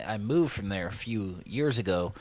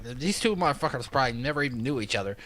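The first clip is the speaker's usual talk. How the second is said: ordinary talk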